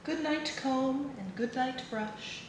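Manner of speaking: ordinary delivery, nothing marked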